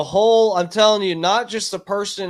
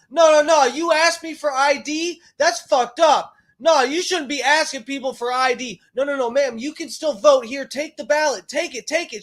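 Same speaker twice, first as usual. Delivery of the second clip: high, loud hammed-up squabble; mock shrill quarrel; 10 semitones higher, 4 dB louder than usual. Mocking the squabble between woman and poll workers, high and loud. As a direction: overlap both sides in a frantic high voice. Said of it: "shrill mocked quarrel at polls".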